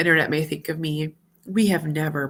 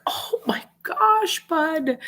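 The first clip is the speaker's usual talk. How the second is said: much higher, slow aching sympathy; tender sympathy; 12 semitones higher, much slower than usual. Retold or imitated. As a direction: melt for the child. Mom moved by son's fear.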